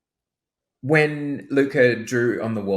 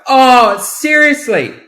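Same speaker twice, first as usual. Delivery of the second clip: loud, much higher "seriously?" in exasperation; angry outburst; 12 dB louder, much slower than usual. Retold or imitated. Mother finding her son drew on the walls, angry shout. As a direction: blow up at the kid. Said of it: "my tone was like".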